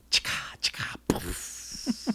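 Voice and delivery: whispered sound effect; imitated noise. Shown as a demonstration. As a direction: make soft, voiceless little effect noises. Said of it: "I would go like this".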